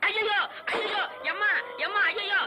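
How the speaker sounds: high-pitched panicked cries; terrified wailing. Acted. Man meeting the dead Saminathan's ghost, terrified wailing. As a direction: cry out for help in terror.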